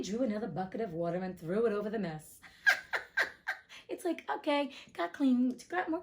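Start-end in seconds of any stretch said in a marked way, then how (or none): 2.4-3.8 real burst of high laughter; high breathy laugh. Real, not acted. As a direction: let the laugh escape. Laughing at the mess.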